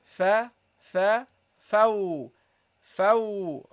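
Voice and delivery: light fa then rounded fau; teacher drills fa; Arabic fa. Shown as a demonstration. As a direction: sound out fa, fau. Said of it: "This is how you pronounce the fa".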